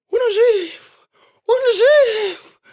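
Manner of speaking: very high, loud joyful shout; joyful cry. A narrator's reading. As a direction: burst out in relief. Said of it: "обрадовались звери".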